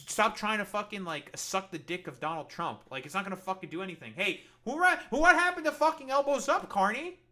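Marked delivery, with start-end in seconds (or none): 4.2-7.2 high, loud and hammed-up indignation; mock outraged shout. Streamer hollering at Carney in mock outrage. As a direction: yell at Carney as if betrayed.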